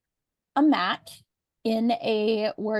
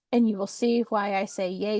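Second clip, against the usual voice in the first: ordinary talk, nothing marked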